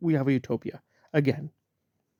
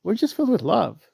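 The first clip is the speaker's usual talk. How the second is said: mocking sweetness; 5 semitones higher than usual. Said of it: "they keep telling us".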